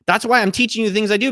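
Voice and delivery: ordinary delivery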